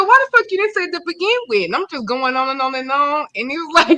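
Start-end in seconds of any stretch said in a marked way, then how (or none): 0.0-1.6 high, fast retold snap; half-joking fury, rattled off quick; mock outrage. Wife retelling how she scolded her husband, mock outrage.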